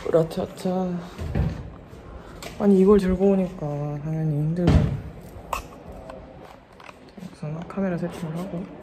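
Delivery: muffled behind mask; speaking through face mask. Real, not acted. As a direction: mask covering mouth.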